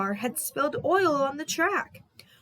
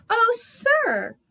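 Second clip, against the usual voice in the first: overjoyed; 12 semitones higher, 6 dB louder than usual. A narrator's reading. Percy overjoyed.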